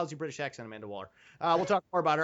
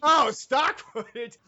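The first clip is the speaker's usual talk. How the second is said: slow, loud and higher, savoring the cheap stock footage; drawn out; 5 semitones higher, 6 dB louder, much slower than usual. Real, not acted. Host mocking the film's cheap stock footage, slow and loud. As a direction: stretch it out like a delighted discovery.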